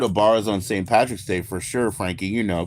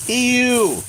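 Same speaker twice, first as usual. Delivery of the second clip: disgusted ew; 13 semitones higher, 5 dB louder than usual. Real, not acted. Disgusted reaction to green beer.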